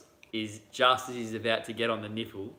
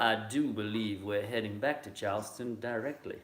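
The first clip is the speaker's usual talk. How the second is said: Frank Underwood voice. Pretend. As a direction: slip into a mild Southern politician's accent. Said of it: "in my Frank Underwood voice".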